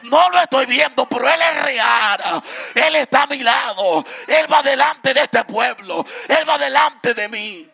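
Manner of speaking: loud, rising sermon cry with a sung lilt; full-force preaching. Acted. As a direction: preach it out over the music to the congregation.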